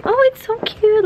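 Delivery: excited. Real, not acted. Seeing the party decorations.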